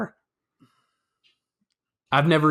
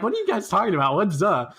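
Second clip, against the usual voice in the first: retold confused question, pitched up; retold bafflement; 6 semitones higher than usual. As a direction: play up being lost, higher voice. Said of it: "I'm like".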